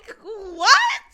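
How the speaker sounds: high, loud burst of laughter; laughing. Real, not acted. Laughing at the groom's words, high, loud disbelief. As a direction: crack up in disbelief.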